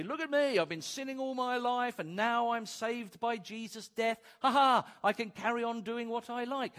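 loud, high, cocky boast; gloating sinner. Retold or imitated. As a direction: crow about getting away with it. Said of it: "grace can say".

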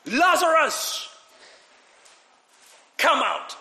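booming command to a dead man; megaphone shout. Acted. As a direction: call him out of the tomb like a megaphone. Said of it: "He, he shouted like a megaphone".

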